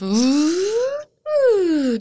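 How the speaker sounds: high whoo sliding down; exaggerated cartoon intonation. Shown as a demonstration. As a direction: ride the lift with a falling whoo. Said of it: "be sound effect".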